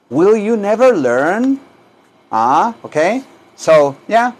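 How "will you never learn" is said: quicker but still slow, raised questioning tune; higher-pitched repeat. Shown as a demonstration. Teacher repeats the question for students, higher and less stretched. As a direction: lift the pitch and swing up at the end. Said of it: "Díganlo".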